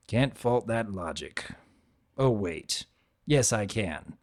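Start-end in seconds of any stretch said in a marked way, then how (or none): none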